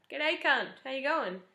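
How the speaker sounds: cheeky Australian greeting to a best mate; cheeky Aussie greeting. Shown as a demonstration. Showing how Australians greet a best friend, cheeky broad Australian. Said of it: "We say the C word for our best friend".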